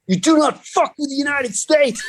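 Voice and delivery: high, hammed-up gangster-officer voice; tough customs officer. Mocking the customs officer who caught him, tough-guy voice. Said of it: "L A gangster dude in a customs uniform".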